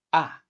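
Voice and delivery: "ah" falling fast from high; short "ah" starting high and dropping; fourth tone. Shown as a demonstration. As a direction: drop "ah" sharply. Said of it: "let's sing ah with the four tones".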